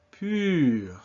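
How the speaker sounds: Pur with long fronted, rounded vowel; French U. Shown as a demonstration. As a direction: Pur as ee said through pursed lips. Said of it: "French U sound".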